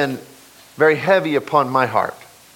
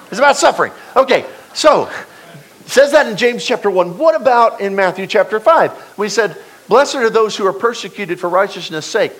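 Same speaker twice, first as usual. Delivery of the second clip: higher, louder pulpit preaching; full-force preaching; 8 semitones higher, 3 dB louder than usual. Acted. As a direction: preach it out with rising fervor.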